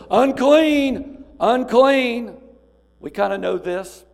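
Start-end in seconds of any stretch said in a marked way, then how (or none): 0.1-2.4 high, loud repeated warning cry of "Unclean!"; leper screaming out. Retold or imitated. Leper warning passers-by to keep away, high loud repeated cry. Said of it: "he would have to scream out continually".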